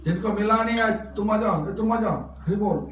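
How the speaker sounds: loud, high call to the crowd; projected call across a gathering. Real, not acted. Calling out to a crowd.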